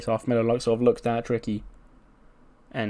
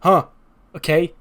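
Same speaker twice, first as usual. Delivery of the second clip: taunting Melo; 5 semitones higher, 6 dB louder than usual. Retold or imitated. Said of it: "Carmelo Hayes sort of looks down and goes".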